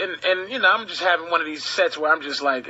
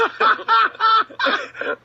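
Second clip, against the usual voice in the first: loud laugh; 13 semitones higher, 4 dB louder than usual. Real, not acted. Laughs at own joke.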